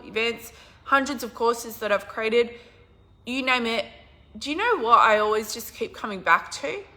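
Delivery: ordinary talk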